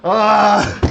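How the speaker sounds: high, loud startled shriek; real shriek. Real, not acted. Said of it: "Literally shrieking".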